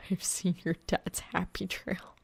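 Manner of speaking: whispered, unvoiced confession; a hushed, ashamed admission; pained confession. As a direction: let it slip out as a tortured whisper.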